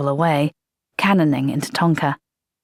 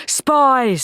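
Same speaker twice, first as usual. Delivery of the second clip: higher, heated "Spies,"; angry; 7 semitones higher than usual. Acted. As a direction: spit out "Spies," in anger. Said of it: "quivering with anger".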